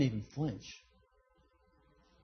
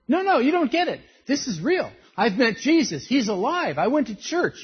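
much higher and louder, urgent; earnest insisting; 8 semitones higher, 6 dB louder than usual. Retold or imitated. Convert insisting to mocking friends, high and urgent. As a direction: plead that it's real. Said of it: "insisting to doubting friends".

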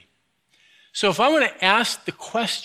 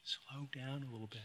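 hushed, gentle mock wife voice; wife's soft advice; 5 semitones lower, 24 dB quieter than usual. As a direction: murmur it like a calm wife beside you. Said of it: "what does my wife say to me".

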